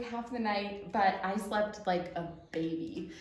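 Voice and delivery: usual speaking voice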